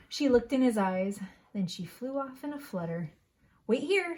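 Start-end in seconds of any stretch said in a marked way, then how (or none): 3.7-4.2 urgent call. Acted. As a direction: call out loudly, higher. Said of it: "she cried".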